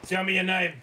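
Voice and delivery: interrogation bark. Acted. Officer grilling the caged prisoner, loud and demanding. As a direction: lean in hard and demand the name.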